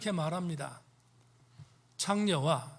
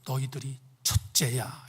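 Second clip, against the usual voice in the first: whispered verdict; 6 semitones lower, slower than usual. Acted. Jesus' pointed verdict, whispered.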